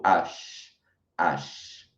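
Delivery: "H" like "acho" without the O; soft ash sound; French H. Shown as a demonstration. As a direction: say "H" as the French letter name ash. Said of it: "É quase como um acho sem o O".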